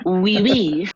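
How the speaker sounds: loud, high hammed-up French "Oui, oui"; mock French accent. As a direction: blurt "Oui, oui" like a cartoon Frenchman. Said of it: "I don't know what accent we're doing".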